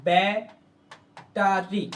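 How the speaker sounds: Battery stretched very slowly; slow drawn-out word. Shown as a demonstration. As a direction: draw out battery syllable by syllable. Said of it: "বললাম কি". (ধীরে টেনে উচ্চারণ)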